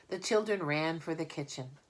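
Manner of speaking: plain reading voice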